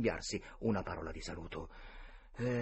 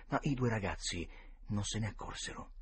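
ordinary delivery, nothing marked

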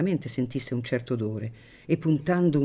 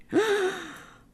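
sudden very high cry of shock; astonished gasp. A narrator's reading. The guests hearing whose hat it is, stunned cry. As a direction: gasp in amazement. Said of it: "esclamarono tutti, aprendo la bocca".

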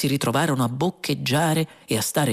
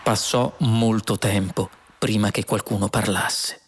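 ordinary delivery, nothing marked

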